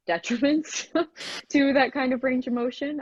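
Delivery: amused, half-laughing. Real, not acted. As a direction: laugh while you say it. Laughing at dancers' joint damage.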